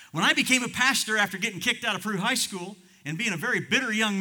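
high, loud sermon delivery; full-force preaching. Real, not acted. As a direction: proclaim it to the back pew.

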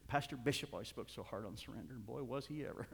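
ordinary talk

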